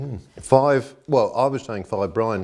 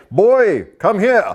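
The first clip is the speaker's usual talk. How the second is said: loud, high mock summons; imitating a booming call; 11 dB louder, much slower than usual. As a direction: bellow it like a stern old boss. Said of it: "he would say".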